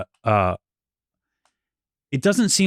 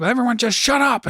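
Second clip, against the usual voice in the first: exasperated dad; 11 semitones higher than usual. Retold or imitated. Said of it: "I'm like".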